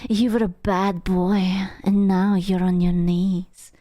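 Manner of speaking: breathy sultry whisper; mock ASMR role-play.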